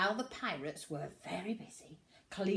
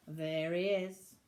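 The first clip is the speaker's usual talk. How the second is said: ordinary delivery, nothing marked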